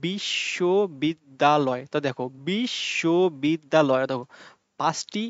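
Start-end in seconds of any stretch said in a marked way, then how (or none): 0.1-1.8 বিশ্ববিদ্যালয় very slow, a clear stop after each of its five syllables. Shown as a demonstration. Teacher demonstrating five syllables to students, very slow. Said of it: "পাঁচটি জায়গায় আমরা থেমে থেমে কথাটা বলছি". 2.5-4.0 বিশ্ববিদ্যালয় very slow, a clear stop after each of its five syllables. Shown as a demonstration. Teacher demonstrating five syllables to students, very slow. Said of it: "থেমে থেমে কথাটা বলছি".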